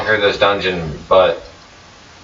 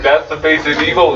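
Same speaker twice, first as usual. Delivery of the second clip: mock Nosta accusation; 8 semitones higher, faster than usual. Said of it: "He looks directly at you and is like".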